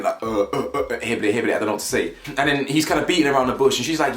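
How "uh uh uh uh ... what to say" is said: mock tongue-tied stammer; stuttering. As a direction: stumble over repeated uhs and nonsense syllables. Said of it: "You can tell he's stuttering".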